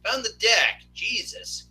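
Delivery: high-pitched, exasperated reassurance; flustered placating. Real, not acted. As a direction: placate someone who keeps yelling. Flustered by friend's demands.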